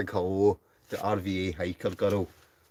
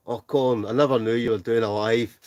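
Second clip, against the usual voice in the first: ordinary talk, nothing marked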